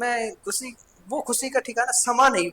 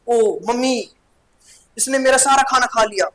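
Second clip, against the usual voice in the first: put-on loud complaining child's wail to mummy; whiny angry child; 4 semitones higher, 6 dB louder than usual. Child complaining to mummy about a sibling, loud whine. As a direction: tattle to mummy, loud and upset. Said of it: "वो होते हैं ना बच्चे ऐसे होते हैं".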